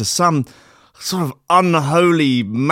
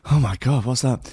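ordinary delivery

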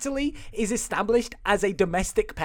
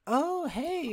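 much higher put-on cooing voice; creepy neighbor; 8 semitones higher, 4 dB quieter than usual. Neighbor greeting the heroine, creepy voice. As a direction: greet as a hammed-up creepy busybody. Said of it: "Her neighbor is like".

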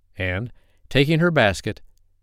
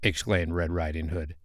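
ordinary delivery, nothing marked

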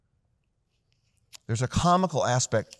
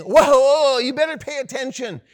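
high, loud whoa of alarm; alarmed warning; 10 semitones higher, 9 dB louder than usual. Acted. Dashboard warning the driver, loud alarmed call.

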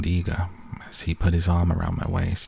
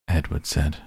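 plain reading voice

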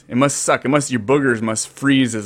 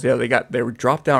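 ordinary talk

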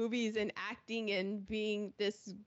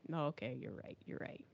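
sheepish; 6 semitones lower, 8 dB quieter than usual. Retold or imitated. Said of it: "Hitchcock was kind of like".

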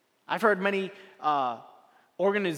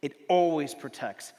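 slow, measured reading, swelling at the end; solemn scripture reading; 3 semitones lower than usual. Acted. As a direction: read it as sacred words.

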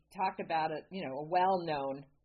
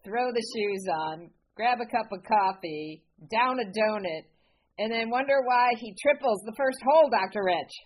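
growing louder and faster; building excitement; 3 semitones higher, 6 dB louder than usual. Real, not acted. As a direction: pile it on, getting louder. Building comic story, rising loud.

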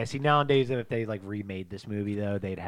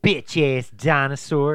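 tough-guy one-liner; 3 semitones higher, 9 dB louder, slower than usual. Acted. Action hero one-liner.

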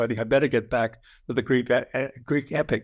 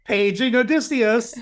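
higher announcer-style page; mock page call; 10 semitones higher, 4 dB louder than usual. Athena pages the shop assistant, mock announcement. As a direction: call him over the shop speaker.

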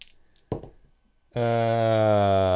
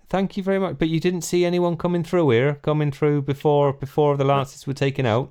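put-on West Country accent; 4 semitones higher than usual. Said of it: "my West Country accent".

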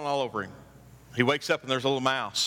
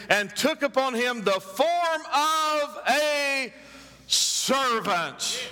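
high, ringing scripture read; preacher reading Philippians aloud, elevated; preaching scripture; 9 semitones higher than usual. Acted. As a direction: proclaim the verse with force. Reads Philippians at full force.

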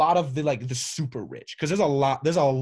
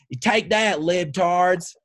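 imitating an angry dad, higher pitch; mock dad's yell, pitched up; retold yell; 3 semitones higher, 4 dB louder, much slower than usual. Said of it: "he starts yelling".

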